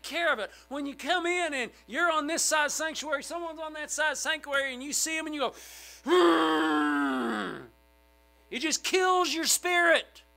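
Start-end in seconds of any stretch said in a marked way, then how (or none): 6.0-7.7 loud, high, drawn-out grudging noise; long exaggerated hmm of dislike; disgruntled hmm. Churchgoer spotting a foe across the sanctuary, disgruntled. Imitates reaction on seeing a foe.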